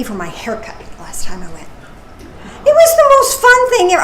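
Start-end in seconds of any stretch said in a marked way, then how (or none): 2.6-4.0 much higher and louder, gushing; excited. Real, not acted. Speaker recalling stranger paying for her haircut, excited outburst. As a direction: burst out with delight.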